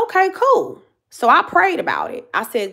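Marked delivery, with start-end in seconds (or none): 0.0-0.8 much higher, mock-breezy brush-off; pitched-up sarcastic shrug of a reply; sarcastic okay. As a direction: toss it off with fake cheer. Retold dismissive reply to mom.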